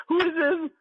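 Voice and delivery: high-pitched, incredulous retold secretary; disbelieving secretary. Secretary to a caller claiming to be Bill Cosby, mock disbelief. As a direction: play a secretary who won't believe the caller. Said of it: "the secretary was like".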